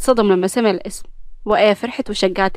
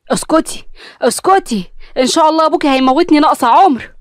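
higher, louder exasperated snap; fed-up mother venting at her daughter; angry, nerves frayed; irritated mother; 5 semitones higher, 4 dB louder than usual. Acted. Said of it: "كانت ابتسام متنرفزة".

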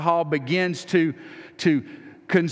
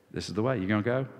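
soft, low, gentle voice of the Spirit, much quieter; gentle Holy Spirit; 8 semitones lower, 6 dB quieter, much faster than usual. Retold or imitated. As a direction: coax them gently, almost a whisper, lower and much softer. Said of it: "He whispers".

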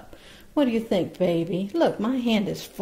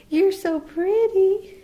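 high pet talk; 12 semitones higher, 5 dB louder, slower than usual. Real, not acted. Owner gushing over her baby bird in high, slow pet talk. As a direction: stretch it up high like praising a pet.

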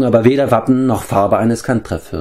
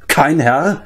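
higher, rising echo in feigned surprise; sarcastic disbelief, pitched up; mocking astonishment; 7 semitones higher than usual. A narrator's reading. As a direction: throw it back as an incredulous, mocking question. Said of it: "mit spöttischem Erstaunen".

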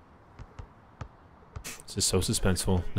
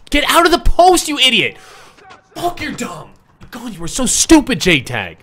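high, loud angry yell; furious shout; 14 semitones higher, 8 dB louder than usual. Real, not acted. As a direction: tear into the teammate at full volume. Raging at teammate J-Tag.

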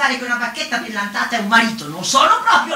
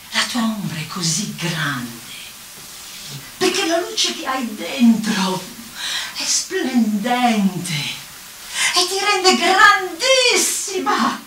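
retold flattery; much slower than usual. Smemi flattering her about her shadow, slow and swelling. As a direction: stretch out the flattery, rising to a big finish.

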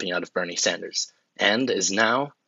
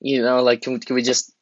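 begging plea; 5 semitones higher than usual. Acted. Asking the American people to settle, pleading. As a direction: beg them, stumbling over words.

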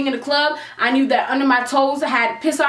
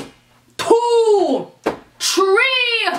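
Jamaican mother counting; 9 semitones higher, much slower than usual. Retold or imitated. Jamaican mother counting down at her late daughter, strict. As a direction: count like a mom about to whoop her kid. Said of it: "Like I was a Jamaican mother".

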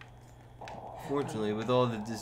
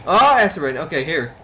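high, loud sudden alarm; startled cry; 4 semitones higher, 12 dB louder than usual. Real, not acted. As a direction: jolt up at the sudden rain. Sudden acid rain in game.

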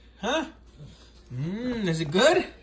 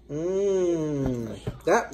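usual speaking voice

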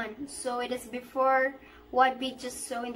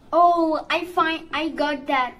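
excited; 2 semitones higher, 7 dB louder, slower than usual. Real, not acted. Sudden find in horror game.

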